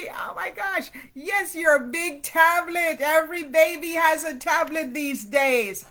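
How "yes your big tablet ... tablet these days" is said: ordinary delivery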